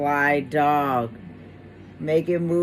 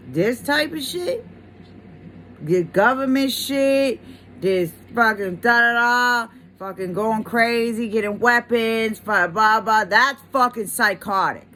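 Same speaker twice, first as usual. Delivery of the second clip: worked-up, higher and louder rant; heated rant; 5 semitones higher, 3 dB louder than usual. Real, not acted. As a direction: get fired up about it. Ranting about government paranoia.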